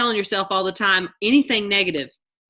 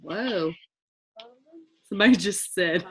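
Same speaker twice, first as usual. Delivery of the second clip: ordinary talk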